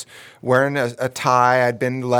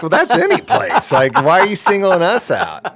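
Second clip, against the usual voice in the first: sarcastic retort; 7 semitones higher, 5 dB louder than usual. Retold or imitated. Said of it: "I'm like".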